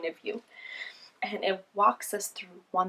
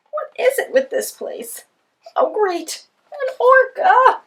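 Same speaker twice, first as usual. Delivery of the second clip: sarcastic, rising to a high yell; fed up and shouting at the sea; exasperated shout; 13 dB louder, slower than usual. Acted. Penguin meets predators.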